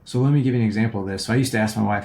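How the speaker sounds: ordinary talk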